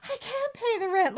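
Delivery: very high, slow fainting wail; fainting damsel. Pretend. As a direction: swoon it out like a melodrama heroine. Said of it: "Like she's, like, fainting".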